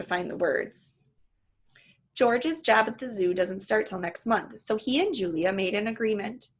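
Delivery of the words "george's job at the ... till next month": plain reading voice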